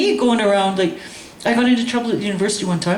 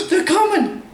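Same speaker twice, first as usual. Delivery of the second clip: high, hammed-up alarm; mock panic; 10 semitones higher, slower than usual. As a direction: cry out a joking warning. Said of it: "he goes".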